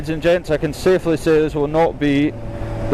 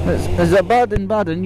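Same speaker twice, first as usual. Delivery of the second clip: mock German accent on Baden; hammy German tourist voice; fake German accent; 4 semitones higher than usual. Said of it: "the fake German accent".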